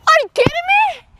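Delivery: high-pitched, incredulous question; amazed disbelief. Retold or imitated. David hearing of the king's reward, high incredulous voice.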